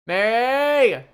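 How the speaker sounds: loud high call across the building. Acted. Jericho calling for Mary in the building, loud shout. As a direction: yell her name to fetch her. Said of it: "is just like".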